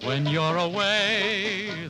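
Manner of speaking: normal talking voice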